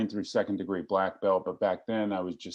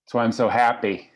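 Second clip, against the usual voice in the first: normal talking voice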